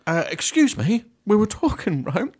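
imitating Steph. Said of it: "Steph just goes like".

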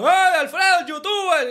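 high-pitched mocking call of his name; mock kids yelling. Neighborhood kids calling out at the youtuber, high mock yell. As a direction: holler it like cheeky kids on the street. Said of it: "a veces por ahí y gritan".

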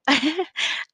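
a short, high, teary laugh; a high little laugh, choked up; emotional laugh. Real, not acted. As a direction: laugh through rising emotion. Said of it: "I'm getting all emotional right now".

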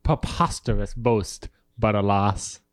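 put-on slow English accent, R dragged in Preposterous; slow, grand English accent; dramatic English accent. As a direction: savour Preposterous and alas like a grand English actor. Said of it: "dramatically, drag out the R".

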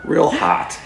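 high sarcastic quip; mock it as sexy. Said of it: "trying not to spit".

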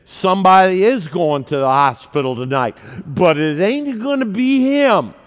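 put-on a little drunk and slurring; slightly slurred. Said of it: "he might have been slurring his words just a little".

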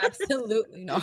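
laughing refusal. Real, not acted. Asked to do a demo, refuses while laughing. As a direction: refuse through laughter, voice lifted. Teased about giving a demo.

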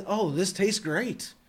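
slow, dry sarcastic praise; mock praise for food that tastes bad. Shown as a demonstration. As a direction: drag it out as if you mean the opposite. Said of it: "be a smart aleck about it".

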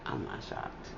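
breathy hushed flat-voiced aside; the friend, unimpressed, barely voicing it; deadpan. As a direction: murmur it, unimpressed. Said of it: "she would be like".

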